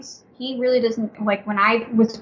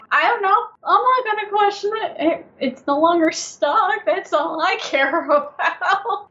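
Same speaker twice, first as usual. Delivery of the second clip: high, amused, laughing through it; 8 semitones higher than usual. Real, not acted. Guide laughing about the unstuck ball, voice high. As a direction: shrug it off while laughing. Laughing retelling of her shrug.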